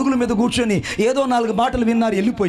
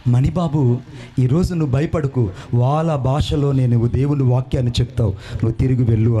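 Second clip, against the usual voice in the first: gentle voice of God; 8 semitones lower than usual. Retold or imitated. Preacher voicing God's gentle voice telling him to go back, low and calm. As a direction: lower and calm, like God speaking. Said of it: "ఒక మెల్లైన స్వరం నేను విన్నాను".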